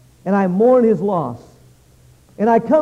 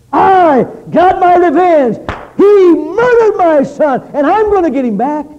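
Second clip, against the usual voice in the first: much higher, louder, slow furious cry; vengeful shout; 9 semitones higher, 7 dB louder than usual. Acted. Grieving father declaring his revenge, furious slow shout. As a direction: hurl each word out in rage.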